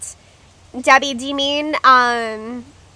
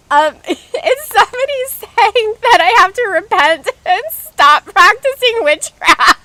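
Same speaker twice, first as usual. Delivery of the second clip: high, loud, cracking up mid-sentence; helpless laughing; 9 semitones higher, 6 dB louder than usual. Real, not acted. Streamer telling viewers about a comment accusing her of witchcraft, laughing helplessly. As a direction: barely get it out through real laughter. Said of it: "I can't stop laughing".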